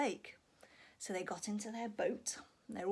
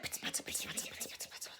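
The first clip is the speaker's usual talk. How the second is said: whispered pitter patter fading away; rain pitter-patter; 8 dB quieter, much faster than usual. Acted. Storyteller whispering rain noises for children, fading away. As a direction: patter softly like raindrops. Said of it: "the rain went".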